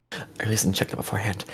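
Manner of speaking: quick whispered aside. Real, not acted. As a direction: whisper it fast under your breath.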